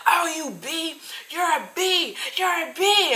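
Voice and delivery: high, mock-shrieking insult, mostly at normal volume; imitating the customer's yelling. The Burger King worker imitates the customer screaming insults at her while she's on the phone with police, high mocking shriek. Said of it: "She's just yelling".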